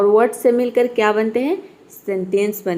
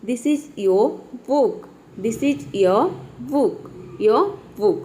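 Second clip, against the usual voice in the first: slow careful This is your book; correct pronunciation; much slower than usual. Shown as a demonstration. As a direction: model the right your for learners. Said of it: "इस तरह से".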